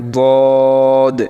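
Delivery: বদ heavy and full, vowel held long; thick dad. Shown as a demonstration. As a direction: fill the mouth and stretch বদ. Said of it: "এটা একটু মোটা করে". (মোটা করে টেনে দোয়াদ)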